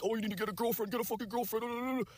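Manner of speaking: high-pitched mocking nag, slowing on the last words; mock nagging parent. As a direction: ham up a parent scolding him to find a girlfriend. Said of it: "were just like".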